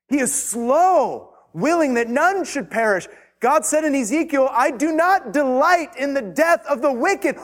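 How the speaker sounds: high, loud preaching, stretching out slow; pleading pulpit voice building in pitch; fervent preaching. Acted. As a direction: preach it with mounting fervour. Preaching at full force, rising.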